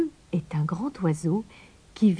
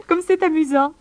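high giggle into a delighted line; laugh, then say it high and amused; tickled, high-pitched little girl; 10 semitones higher, 5 dB louder than usual. A narrator's reading. Amused Mouche, unafraid, laughs.

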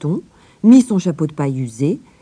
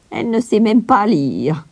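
contemptuous surprise; 6 semitones higher than usual. A narrator's reading. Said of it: "s'étonna la gouvernante avec mépris".

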